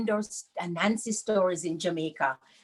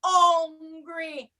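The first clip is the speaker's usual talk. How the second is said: slow, higher, louder "Mama, me hungry." whined; dramatic child to mommy; 7 semitones higher, 5 dB louder, slower than usual. Acted. Child telling mommy it is hungry, whining. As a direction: beg like a starving child. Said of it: "So you said to mommy".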